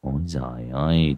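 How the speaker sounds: low, soft muttered "Ôi giời ơi" with a falling end; a quiet, greedy mutter. Acted. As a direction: mumble "Ôi giời ơi" low under the breath. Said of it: "gật gù miệng lẩm bẩm".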